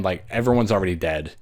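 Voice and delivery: usual speaking voice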